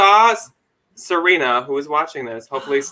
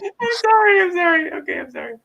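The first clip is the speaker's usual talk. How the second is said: apologizing through high laughter; giggling, much higher, can't stop; laughing apology; 13 semitones higher than usual. Real, not acted. Apologizing while laughing hard.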